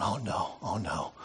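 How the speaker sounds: low, hushed worried muttering; understated "oh no" under the breath; retold nervousness. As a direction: imitate quiet, dawning worry. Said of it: "now he's getting really nervous".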